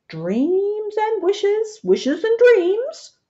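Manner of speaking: high, slow, teasing wizard lilt; sly wink. A narrator's reading. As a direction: sing it out with a wink, slowly. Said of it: "the wizard said with a wink".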